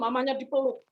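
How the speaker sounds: ordinary delivery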